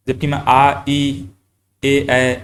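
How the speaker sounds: "आ, ई, ए, ऐ" said with lips spread; unrounded vowels shown. Shown as a demonstration. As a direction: contrast these unrounded vowels. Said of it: "इस प्रकार से बोल रहा हूँ". (अवृत्तमुखी स्वर "आ, ई, ए, ऐ" बोलकर)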